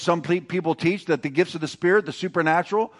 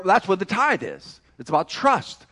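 ordinary talk, nothing marked

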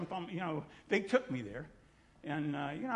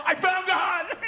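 high, loud, laughing exclamation; excited shout back; 13 semitones higher, 10 dB louder, slower than usual. Retold or imitated. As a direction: echo their joy at full volume. Answering the girls in kind.

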